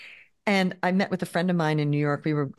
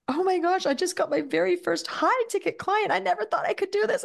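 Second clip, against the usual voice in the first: high-pitched imitation of an overjoyed, tearful client; retold happy-crying voice, pitched way up; tearful joy; 13 semitones higher than usual. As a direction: gush it, as if happy tears are coming. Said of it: "they're crying".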